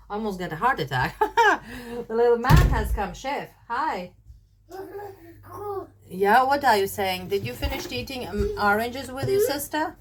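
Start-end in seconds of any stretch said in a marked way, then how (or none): 2.1-10.0 high, sweet pet-talk voice; talking to a parrot. Real, not acted. Greeting the parrot that arrived.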